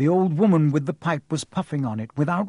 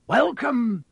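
grand welcome; 10 semitones higher, 3 dB louder than usual. Acted.

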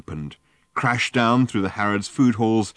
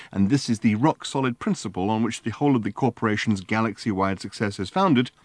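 usual speaking voice

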